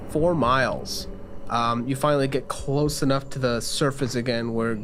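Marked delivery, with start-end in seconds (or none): none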